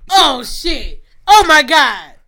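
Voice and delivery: loud, high gleeful disbelief; excited shock. Real, not acted. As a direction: blurt it out loud, thrilled by the gossip. Reacts to friend copying fedoras.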